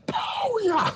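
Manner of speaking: high-pitched explosive whoop; drugged-up yell. Acted. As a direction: yell it like a hit just landed. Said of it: "just yell like".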